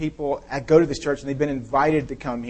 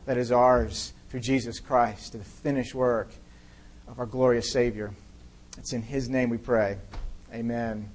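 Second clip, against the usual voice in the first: prayer; 3 dB quieter than usual. Real, not acted. Pastor praying over the congregation, soft and earnest. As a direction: pray quietly and earnestly before God.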